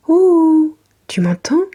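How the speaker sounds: calling out. Acted. Calling out of window.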